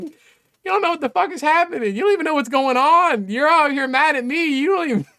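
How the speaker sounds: high-pitched, incredulous scolding; worked-up, disbelieving complaint; exasperated rant. Real, not acted.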